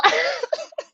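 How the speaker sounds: high squealing laugh. Real, not acted. Laughs mid quote.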